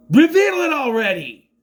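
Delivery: high, loud exasperated yell; impatient shout. Retold or imitated. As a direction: holler impatiently at the characters.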